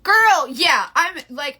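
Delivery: normal talking voice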